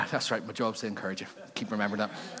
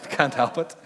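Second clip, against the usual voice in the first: ordinary delivery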